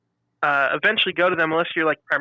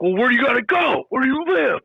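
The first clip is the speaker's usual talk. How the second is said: higher, pushy customer voice, pressing hard; a higher, insistent voice for the pushy host; 8 semitones higher than usual. Retold or imitated. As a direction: mimic a host who won't take no. Retold pushy customer quote.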